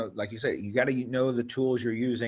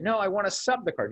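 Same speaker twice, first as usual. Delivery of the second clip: mock-insistent coach, pitched up high; coach's demand; 8 semitones higher than usual. Coach to referee over the card, higher insistent demand. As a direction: play the coach pushing back on the ref, higher. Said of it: "And then".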